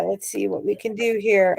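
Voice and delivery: normal talking voice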